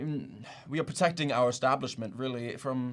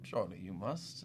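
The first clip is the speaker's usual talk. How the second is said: ordinary talk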